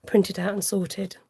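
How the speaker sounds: ordinary delivery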